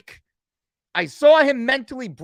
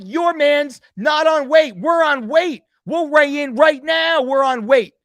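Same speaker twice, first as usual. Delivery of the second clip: retold yelling; 3 semitones higher, 5 dB louder than usual. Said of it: "I'm like".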